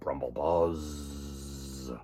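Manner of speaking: deep slow engine rumble; low rumble sound. Acted. Spaceship rumbling away, low and slow. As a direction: draw it out low like a ship.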